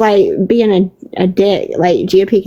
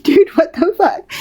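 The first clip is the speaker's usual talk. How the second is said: higher-pitched, imitating someone cracking up; retold amused disbelief; 8 semitones higher than usual. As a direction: quote a friend reacting through laughter. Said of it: "Like, he's laughing".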